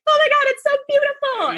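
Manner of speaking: very high, gushing mock weep; hammed-up overwhelmed by beauty; imitated happy crying. Crier moved by something beautiful, mock weepy. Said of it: "Half my cries are like".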